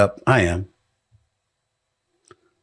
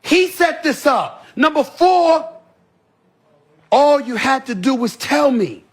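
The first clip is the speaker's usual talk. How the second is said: building shout; 7 semitones higher, slower than usual. Real, not acted. Counting points, rising to shout.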